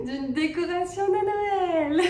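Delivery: high giggly confession; laughing embarrassed. Real, not acted. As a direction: laugh through the words. Embarrassed reveal.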